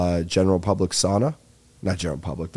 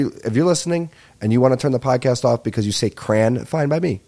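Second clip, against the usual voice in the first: normal talking voice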